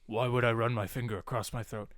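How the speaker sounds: Drax impression. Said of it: "and he's like".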